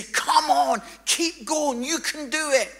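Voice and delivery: loud, high-pitched crowd cheer; urgent shouted encouragement; screamed cheering. Retold or imitated. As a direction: yell them on like a roadside crowd. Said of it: "began to scream and say".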